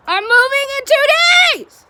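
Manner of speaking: loud, high-pitched outburst; exasperated shout. Acted. Venting about car trouble right before a move, exasperated shout.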